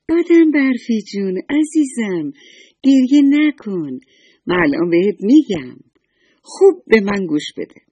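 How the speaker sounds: high, loud, cooing comfort; kind sun lady. Acted. Sun lady comforts the crying snowman, high and loud. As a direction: soothe the crying snowman like a loving mother. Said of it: "خورشید خانم خندید و گفت".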